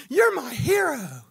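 put-on high, slow, loud childlike gratitude; little girl thanking her hero; adoring little girl. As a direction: say it like a kid hugging her hero. Child hugs him, says hero.

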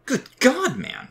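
high, loud startled yelp; sudden high-pitched outburst; startled shout. A narrator's reading. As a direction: cry out in alarm at someone sneaking up. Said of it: "Sam shouted".

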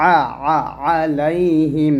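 আলাইহিম starting with a squeeze from mid-throat, vowel kept thin; pressed, thin ayn. Shown as a demonstration. Teacher demonstrates the letter ayn, throat-pressed thin আ. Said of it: "গলার মাঝখান থেকে চাপ দিয়ে চিকন করে".

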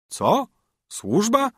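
wysoko, drwiąco: „Służba?” z mocno wznoszącą intonacją; wyśmiej jej pytanie jak zaskoczony żebrak; zdziwione niedowierzanie. A narrator's reading. Beggar mocks her question. (grajek wyśmiewa pytanie królewny o służbę, z drwiącym zdziwieniem)

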